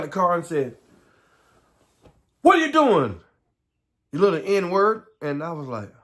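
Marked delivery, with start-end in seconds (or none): 2.4-5.1 loud, high, hostile shout; angry yell. Retold or imitated. Grown man yelling threats at a boy, loud and angry. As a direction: bark it at a scared kid.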